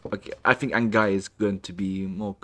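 ordinary talk, nothing marked

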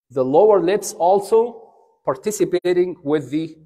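ordinary talk

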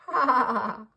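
loud real-sounding ha ha; laugh. Shown as a demonstration. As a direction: laugh out heartily.

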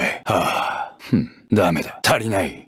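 normal talking voice

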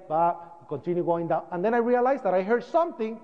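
ordinary talk, nothing marked

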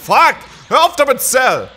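high, loud yell of protest; angry shout. Real, not acted. Player being comboed by Cell, angry shout. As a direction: shriek it at the enemy who won't stop. Gamer getting beaten by Cell.

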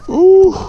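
loud, high "ooh"; excited whoop. Real, not acted. Angler after landing a big fish, excited whoop. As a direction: let out one piercing whoop.

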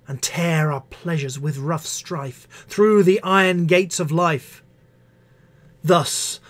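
rising, impassioned verse recital; poetry reading. Acted. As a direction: build the poem to a passionate close. Reads Marvell poem aloud.

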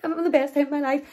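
high, gushing mock-cheerful voice; squealing fake hello, hammed-up. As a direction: parody a fake happy vlogger. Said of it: "being like".

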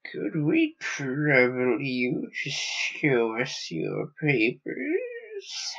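very slow, sibilant alien voice. Acted. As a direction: let each word hiss out slowly. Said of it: "their voices were sibilant".

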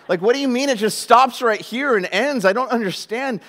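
high, loud, incredulous protest; comic, worked-up disbelief; baffled protest. Acted. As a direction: push back in exaggerated confusion. Acts out bafflement at abrupt ending.

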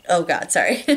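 plain reading voice

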